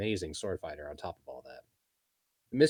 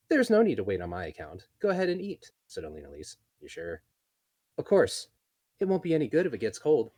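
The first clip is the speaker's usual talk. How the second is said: higher, louder friendly elf; kind elf voice; 4 semitones higher, 7 dB louder than usual. Acted. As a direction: urge her kindly. Elena voiced.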